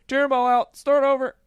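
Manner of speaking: mock George echoing. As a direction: parrot her order in a high meek voice. George echoing wife's order.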